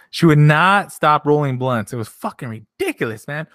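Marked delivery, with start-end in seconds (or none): 0.1-1.9 louder, slow, press on "not"; emphatic slow stress. Real, not acted. Streamer telling chat about the blunts, emphatic. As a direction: lean hard on "not", drawn out.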